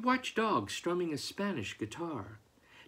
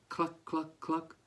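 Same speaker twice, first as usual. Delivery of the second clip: gentle clucking; 4 dB quieter than usual. Acted. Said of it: "gentle clucking".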